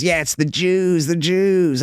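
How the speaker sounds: high, slow put-on old lady voice; little old lady voice. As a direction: caricature a prim lady in pink, drawn out. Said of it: "little bitty lady all dressed in pink ... she goes".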